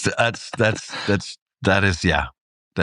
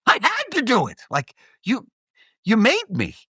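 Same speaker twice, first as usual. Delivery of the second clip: even higher pleading whine; plaintive; 13 semitones higher than usual. Retold or imitated. Bully blaming the victim, mock plaintive high whine. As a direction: insist pitifully you had no choice. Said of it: "looking at me plaintively like".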